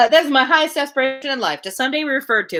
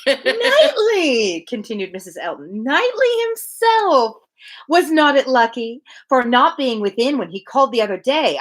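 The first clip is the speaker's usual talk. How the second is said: gushing Mrs Elton. Acted. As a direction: savour the name Knightley, thrilled.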